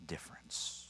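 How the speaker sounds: breathy whisper landing the point; whispered word. Real, not acted. Preacher whispering the key word to the congregation. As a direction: drop to a whisper on the last word.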